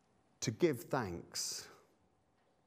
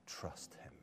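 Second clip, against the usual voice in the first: whispered; 11 semitones lower, 15 dB quieter than usual. Acted. Preacher quoting Job to the congregation, whispering. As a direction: drop to a hushed whisper on trust him. Quoting Job's words of faith.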